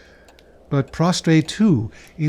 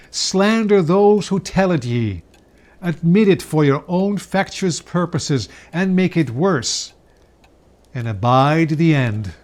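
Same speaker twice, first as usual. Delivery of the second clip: wrathful ghost; 3 semitones higher, 4 dB louder than usual. Acted. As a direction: hurl the charge in anger.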